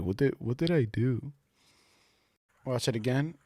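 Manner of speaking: a soft muffled echo of what he hears; imitating a muffled voice. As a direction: copy the mumbled clip softly. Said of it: "he kinda goes like".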